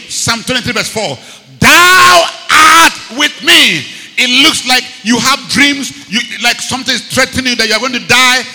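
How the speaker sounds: loud, fervent sermon delivery; preaching at full force. Real, not acted. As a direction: drive the psalm home to the church.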